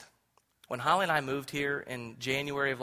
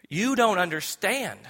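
retold cry; 6 semitones higher, 7 dB louder than usual. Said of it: "I literally cried out".

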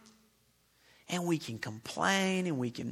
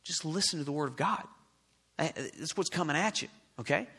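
ordinary delivery

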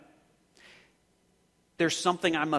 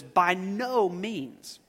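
slow reading; much slower than usual. Shown as a demonstration. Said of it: "My Bible says".

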